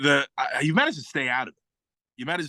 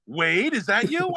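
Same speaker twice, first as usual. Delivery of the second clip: higher, drawn-out movie question; slow, raised-pitch delighted recognition; excited recognition; 6 semitones higher, slower than usual. Retold or imitated. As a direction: imitate recognising an old buddy mid-fight. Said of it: "that scene where he's at the end".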